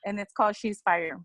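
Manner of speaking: ordinary delivery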